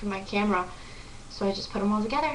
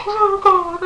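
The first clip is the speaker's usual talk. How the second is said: loud, high-pitched re-enacted gasp of awe; retold starstruck squeal; 12 semitones higher, 9 dB louder than usual. Fan meets her idol, loud high overwhelmed squeal.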